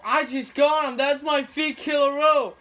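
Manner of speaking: pumped up, loud and high; excited. Real, not acted. Gamer cheering his fifth kill in a row, excited. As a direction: celebrate the kill streak out loud.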